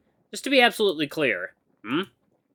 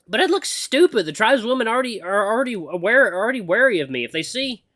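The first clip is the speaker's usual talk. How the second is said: higher-pitched, flustered protest; protesting heroine; 9 semitones higher, 4 dB louder than usual. Acted. Reluctant pupil objecting to her mentor, flustered.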